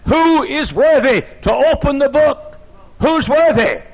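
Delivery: high, proclaiming angel's challenge; ringing acted summons; calling out. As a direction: cry it out as a preacher voicing an angel. Angel's challenge voiced by the preacher.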